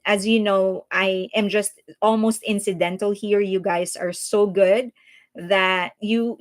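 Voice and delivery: ordinary delivery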